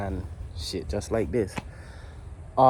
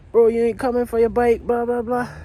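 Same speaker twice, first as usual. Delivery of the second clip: imitating a Virgin Islands accent, much higher and louder; mock the friend's Virgin Islands accent, pitched way up; 11 semitones higher, 5 dB louder than usual. Best friend calls pushing the sale, imitated Virgin Islands accent, high and loud. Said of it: "he hit me with the accent".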